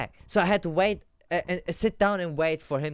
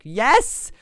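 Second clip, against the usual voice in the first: higher and louder, outraged questions; indignant; 8 semitones higher, 7 dB louder than usual. Real, not acted. Traveller venting to viewers about the search, indignant. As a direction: protest in disbelief at the treatment.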